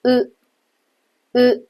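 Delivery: 呃 with lips hardly rounded; unrounded Japanese う for 呃; 呃 said with little lip protrusion. Shown as a demonstration. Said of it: "你的嘴唇也不会往前突出很多".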